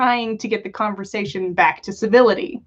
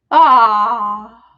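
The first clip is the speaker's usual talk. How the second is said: a loud, regretful sigh of a word; 7 dB louder than usual. A narrator's reading. Tom to Albus, turned down, with audible regret. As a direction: let the disappointment show. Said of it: "regret audible in his voice".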